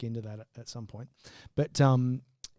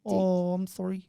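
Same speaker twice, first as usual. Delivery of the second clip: mock Kiwi accent; 5 semitones higher, slower than usual. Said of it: "we both have terrible accents".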